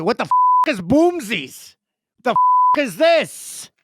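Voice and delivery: high, loud mock bewildered guy; hammed-up confused buddy; baffled golf buddy. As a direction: squawk it like a confused golfer. Golf buddies' baffled reaction retold.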